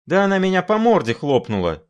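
high indignant squeal. A narrator's reading. Sharikov squealing back at the doctor, high and indignant. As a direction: squeal it in outrage. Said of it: "взвизгнул Шариков".

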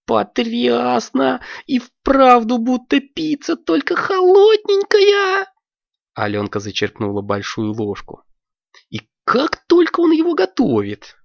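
high, squeaky and excited; a high child's voice begging to order fast; eager little girl. Acted. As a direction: bounce with impatience for ice cream. Алёнка delighted.

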